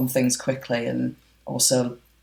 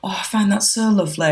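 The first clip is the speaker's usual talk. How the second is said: high-pitched imitation of a touched mother; gushing mother; 7 semitones higher than usual. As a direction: coo it like the bride's mother. Voices the gushing mother of bride.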